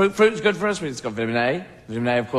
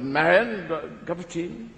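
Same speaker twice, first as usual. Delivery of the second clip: put-on English accent.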